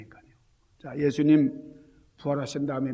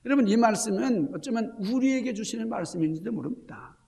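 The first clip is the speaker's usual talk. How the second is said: ordinary talk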